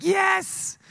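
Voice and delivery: very high, drawn-out yes; gleeful mock cheer. Preacher reliving glee at his teammate's soaking, mock-triumphant squeal.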